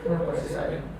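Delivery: breathy whispered endearment; tender whisper. Pretend. Husband whispering lovingly to his wife. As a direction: whisper it lovingly, holding her hand. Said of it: "Pegangin tangannya".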